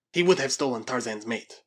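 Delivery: plain reading voice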